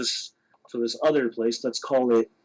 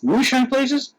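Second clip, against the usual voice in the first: high, surprised mock query; incredulous question; 10 semitones higher, slower than usual. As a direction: question it in disbelief, pitched up. Questioning friend's suggestion in retold exchange.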